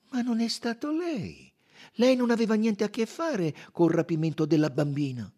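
high, loud detective; emphatic, high-pitched Poirot; Poirot, firm. A narrator's reading. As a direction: counter him firmly in a high voice.

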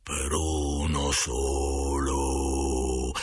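low, very slow spectral drone; eerie, hollow ghost moan; solemn ghost. A narrator's reading. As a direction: intone it like a spirit, stretching every vowel. Narrator plays the ghost answering.

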